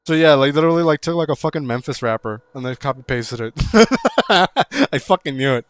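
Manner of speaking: loud, laughing that he knew it; excited realisation. Real, not acted. Streamer finds the lyrics were copied, loud excitement. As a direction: burst out with it. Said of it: "loud realisation it was copied".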